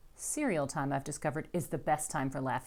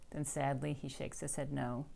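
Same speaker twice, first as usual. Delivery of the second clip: ordinary delivery, nothing marked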